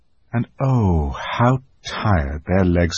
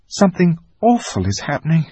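put-on higher and fast, alarmed girl; frightened; 8 semitones higher, faster than usual. A narrator's reading. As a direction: rush it out in dread. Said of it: "said Susan".

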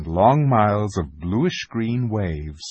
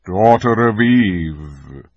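slow, louder, solemn lion; graver voice; 6 dB louder, much slower than usual. A narrator's reading. Aslan reproves Lucy as Daughter of Eve, grave. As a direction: draw it out with grave authority. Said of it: "said Aslan in a graver voice".

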